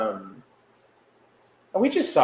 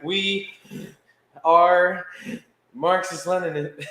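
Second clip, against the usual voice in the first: high, with pauses between each word; mock-solemn slogan, every word spaced out; proud declaration; 7 semitones higher, 3 dB louder, slower than usual. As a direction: announce it word by word, grandly. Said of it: "then Cutie's like".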